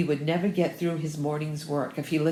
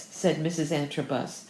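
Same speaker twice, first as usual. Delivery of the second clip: plain reading voice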